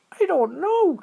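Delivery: very high, excited little squirrel voice; high squeaky critter voice. A narrator's reading. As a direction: chirp it out like a tiny squirrel. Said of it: "said Squirrel".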